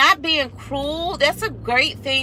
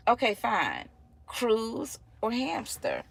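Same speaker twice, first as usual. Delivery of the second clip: softer, slow bargaining; sly quiet offer; 7 dB quieter than usual. Retold or imitated. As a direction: tempt the kid quietly. Mom offering a sly choice.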